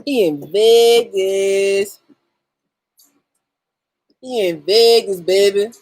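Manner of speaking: drawn-out envy. Acted. Savoring viewer in Vegas.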